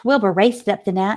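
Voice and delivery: ordinary delivery, nothing marked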